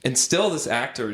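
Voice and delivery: usual speaking voice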